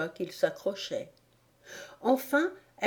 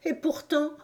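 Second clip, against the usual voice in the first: high, plaintive pigeon voice; resume the tearful complaint; high-pitched lament; 8 semitones higher than usual. A narrator's reading.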